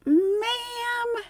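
high, slow, gentle ma'am; gentle correction. Retold or imitated. Agent gently tells the old lady the bag isn't hers, slow. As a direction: let her down gently and slowly.

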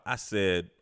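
ordinary delivery

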